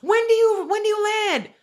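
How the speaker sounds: high-pitched mimicked child voice; imitated little niece. Aunt imitating her niece calling from the background, eager high voice. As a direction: pipe up high like an eager kid. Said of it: "she was in the background".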